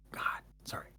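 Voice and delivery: sheepish whisper. Real, not acted. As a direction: whisper it, a little embarrassed. Embarrassed whispered admission.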